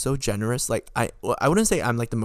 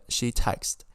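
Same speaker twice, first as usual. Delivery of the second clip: normal talking voice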